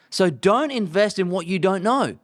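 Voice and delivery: ordinary delivery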